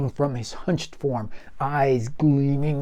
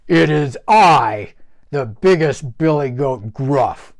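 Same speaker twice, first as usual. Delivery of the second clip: big goat proclaiming; 2 semitones higher, 9 dB louder than usual. Acted. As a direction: proclaim it with full power. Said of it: "he proclaimed".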